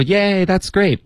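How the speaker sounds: high-pitched group cheer of delight; high, gleeful congratulation; happy cheer. Acted. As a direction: cheer for someone's good news, voice jumping up. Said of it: "大家全部都开始为他感到高兴啊".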